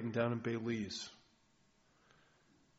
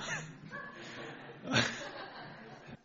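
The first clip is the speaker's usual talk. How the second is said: a quick airy laugh at his own joke; breathy laugh; 7 dB quieter than usual. Real, not acted. Preacher laughing at his joke about the garage, airy laugh. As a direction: let a soft unvoiced laugh escape.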